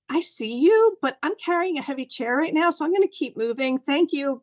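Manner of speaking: high-pitched, hurried-sounding brush-off with thanks; busy acknowledgement. Retold or imitated. Woman carrying a chair talks to a butterfly, politely harried. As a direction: acknowledge it kindly but keep going.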